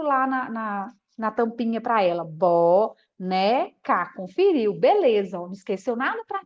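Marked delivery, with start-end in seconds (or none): 2.2-4.1 very slow, lower, BO... NE... CA; syllable by syllable. Shown as a demonstration. Teacher showing the syllables again to a child, very slow and segmented. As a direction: stretch BONECA into three separate beats. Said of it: "A gente vai falar mais pausado do que de normal".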